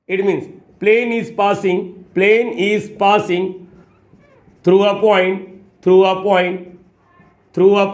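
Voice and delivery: very slow, stretched dictating pace; slow dictation. Shown as a demonstration. Teacher dictating slowly to students as he writes. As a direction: stretch every word as you write.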